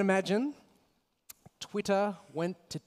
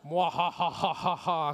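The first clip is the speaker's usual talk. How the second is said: mwah-ha-ha Bond villain cackle; evil villain laugh. Acted. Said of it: "Kinda sound like a Bond villain".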